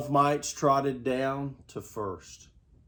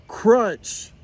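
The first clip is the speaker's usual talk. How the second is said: higher, louder crunch; splintering crunch sound; crunch effect; 7 semitones higher, 4 dB louder than usual. A narrator's reading. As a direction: crunch it like a breaking bat. Bat breaks.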